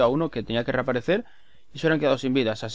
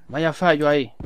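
ordinary delivery

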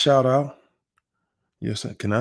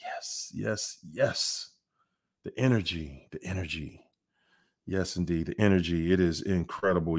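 hushed and grateful; 8 semitones lower, 8 dB quieter than usual. Real, not acted. Host quietly soaking in the chat's love, hushed. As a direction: murmur thanks like catching your breath.

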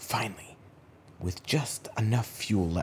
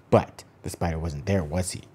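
usual speaking voice

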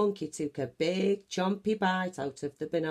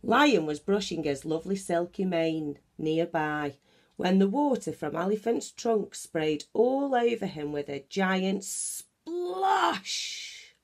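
plain reading voice

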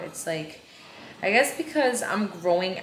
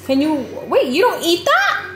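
surprised; 11 semitones higher, 7 dB louder than usual. Real, not acted. Mother reacting to her child.

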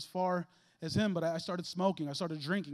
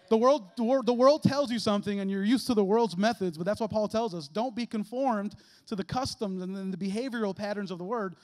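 loud sermon cadence, slowing on be transformed; preaching at full force; 6 dB louder than usual. Real, not acted. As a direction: preach it out to the church.

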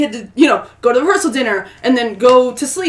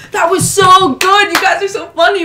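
higher, louder mock enthusiasm; imitated gushing praise; 7 semitones higher, 6 dB louder than usual. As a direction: play a delighted wedding guest raving. Guests gushing praise in retold scene.

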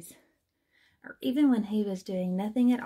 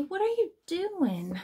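high-pitched storybook outcry; mock roar; 9 semitones higher than usual. A narrator's reading. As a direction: exclaim it high, as a startled, roaring character. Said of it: "roared Benny".

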